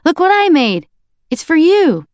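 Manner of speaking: put-on high, loud little boy voice; excited toddler. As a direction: show off a drawing like a toddler.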